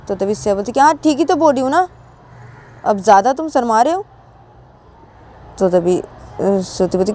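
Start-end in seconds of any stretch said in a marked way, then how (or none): 0.8-4.0 high-pitched, insistent comeback; acted teasing insistence; brazen retort. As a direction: brush off the embarrassment, voice lifted. Brushes off friend's embarrassment.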